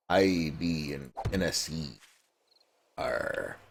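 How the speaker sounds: lightly voiced pirate growl; a hint of a pirate, slow. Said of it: "I'm talking like a pirate".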